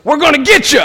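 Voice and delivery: hammed-up taunting yell; yelled threat. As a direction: shout a goofy threat toward the woods. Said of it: "And then he goes".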